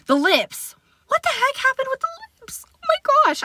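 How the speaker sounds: very high exclaim of disbelief; can't believe what happened to the lips; exasperated disbelief. Real, not acted. To viewers about her ruined lips, a very high exasperated cry. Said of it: "I got so mad".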